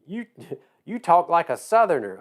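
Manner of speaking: badly faked Missouri accent; attempted Missouri accent. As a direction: try a Missouri accent and miss. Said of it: "I can't even do a Missouri accent now".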